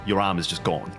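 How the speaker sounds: ordinary delivery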